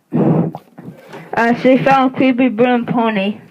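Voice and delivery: ordinary talk, nothing marked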